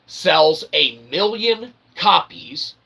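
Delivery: ordinary talk, nothing marked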